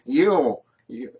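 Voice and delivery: half-hearted nerdy voice; a weak try at a nerd. Pretend. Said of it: "I can't do a very good nerd voice".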